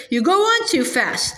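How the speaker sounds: usual speaking voice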